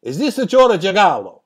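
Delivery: exaggerated Italian accent, quick and punchy; Dino De Laurentiis Italian accent. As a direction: ham up a thick Italian accent on the phone. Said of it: "Italian accent says to me".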